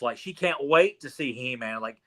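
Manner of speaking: ordinary delivery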